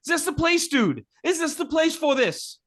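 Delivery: high incredulous question; exasperated. Real, not acted. Complaining about the pep talk scene, high and incredulous. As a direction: ask it in disbelief. Mocking a badly timed scene.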